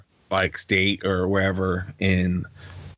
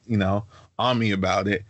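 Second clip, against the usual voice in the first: ordinary delivery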